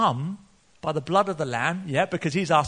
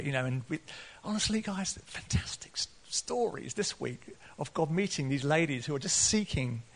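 usual speaking voice

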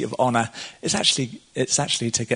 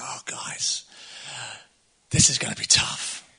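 hushed Jesus; 5 dB quieter, slower than usual. Acted. Jesus voiced, despairing aside.

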